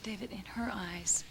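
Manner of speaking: hushed fearful whisper. Acted. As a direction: confide it in a whisper. Confiding her fear.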